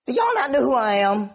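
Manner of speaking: high, incredulous, hammed-up boast; mock self-importance. Preacher imagines Jesus boasting to mourners, mock self-important. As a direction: act amazed they don't recognize you.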